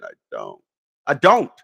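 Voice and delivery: normal talking voice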